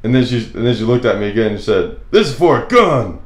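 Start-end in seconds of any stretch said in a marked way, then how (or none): 2.1-3.2 higher, louder, pointed accusation; suspicious cashier. Retold or imitated. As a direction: mimic the cashier pressing the point. Cashier repeats objection, suspicious.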